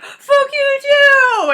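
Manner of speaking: very high, loud, slow screech; screeching comeback. Retold or imitated. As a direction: screech it back like an outraged man. Harasser shouts back, impersonated.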